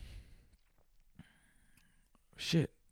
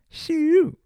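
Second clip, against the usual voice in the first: shoo; 6 dB louder than usual. Acted. Reacting to tequila shots.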